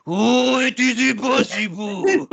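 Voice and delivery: high, slow put-on Japanese accent; hammy Japanese accent, drawn out; Japanese accent impression. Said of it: "doing Cheatham's Japanese one".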